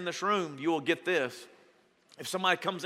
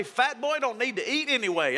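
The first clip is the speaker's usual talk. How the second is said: a higher, hammed-up shrug of a line; mock self-consoling grumble; 7 semitones higher than usual. The preacher consoling himself over a ruined sandwich, in mock grumble. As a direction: brush it off with mock resignation. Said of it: "And you know what I say?".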